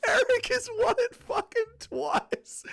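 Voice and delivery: slow, high, wailing fake sob; hammed-up crying lament; mock crying. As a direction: whine it like you're crying over the loss. Mock despair over repeat winner.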